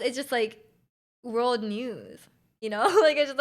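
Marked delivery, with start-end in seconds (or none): none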